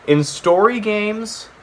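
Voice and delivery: ordinary talk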